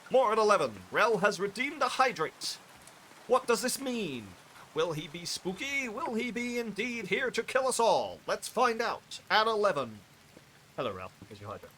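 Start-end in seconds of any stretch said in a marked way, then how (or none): 0.1-0.7 higher and louder, like a TV newsreader; hammed-up news anchor. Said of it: "I don't know why I said it like that". 0.9-2.6 higher and louder, breaking-news headline; hammed-up news anchor. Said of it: "I don't know why I said it like that". 3.3-10.0 higher and louder, mock-ominous anchor; spooky news teaser. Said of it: "I don't know why I said it like that".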